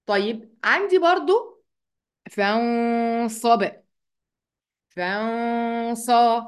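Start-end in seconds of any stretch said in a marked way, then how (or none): 2.3-6.4 "فانصب" with the n as a long nasal hum; hidden noon with ghunnah. Shown as a demonstration. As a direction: stretch a level hum through the nose before the sad in "فانصب". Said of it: "الغنة اللي هي الصوت اللي بيطلع من المناخير".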